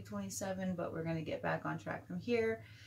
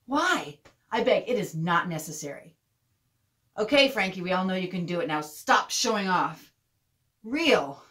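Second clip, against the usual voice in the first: loud, fed-up plea to stop; exasperated telling-off; 10 dB louder than usual. Acted. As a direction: scold a show-off kid. Acted telling-off of Frankie.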